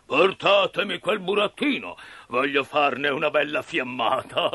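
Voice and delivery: big ogre voice, gloating; menacing puppeteer. Acted. Puppeteer calling for firewood for his roast, menacing and gloating. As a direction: order it with wicked relish. Mangiafuoco figure.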